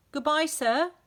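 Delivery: high, loud cheeky call. A narrator's reading. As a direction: call it sweetly across the yard. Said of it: "called out Rebel".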